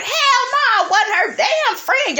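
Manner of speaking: imitating a screechy woman going off; screechy imitation. Melody going off at Miss Wanda, screechy imitation. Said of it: "Got that screechy voice".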